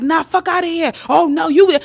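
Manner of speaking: mock outrage, pitched high; put-on indignant protest, hammed up; acting upset. Guy playing offended to dodge her question, mock upset. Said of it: "They know how to act all upset".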